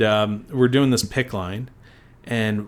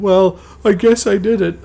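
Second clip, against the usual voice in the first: higher, louder imitation of a son owning up; son's grudging confession; 10 semitones higher, 7 dB louder than usual. Son confessing to Dad, high mock-reluctant voice. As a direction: mimic a kid reluctantly admitting it.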